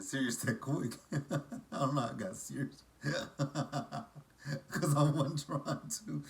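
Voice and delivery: laughing through the words; real giggles at yourself; high, helpless laughter while talking; cracking up. Real, not acted. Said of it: "it was funny to me".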